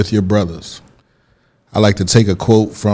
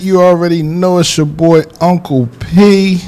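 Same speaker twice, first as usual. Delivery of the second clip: radio host intro; 8 semitones higher, slower than usual. Acted. Radio show opening intro.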